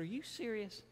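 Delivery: high-pitched disbelieving question; incredulous. Retold or imitated. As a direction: ask it in sheer disbelief. Patient asks again, incredulous.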